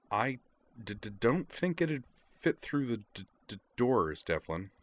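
halting, stuttered consonants; stammer. A narrator's reading. Kate stammers throughout her dialogue.

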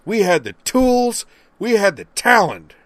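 loud high slow victory chant; slowed, shouted boast; triumphant shout. Acted. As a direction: crow it out over the cheering. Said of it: "Winston Zeddemore burst out".

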